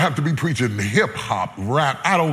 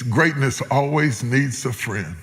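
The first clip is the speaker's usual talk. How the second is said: lower, gentle, reflective; tender preaching; 6 semitones lower than usual. Acted. Preacher comforting listeners about greatness, low tender voice. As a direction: ease down and speak it gently.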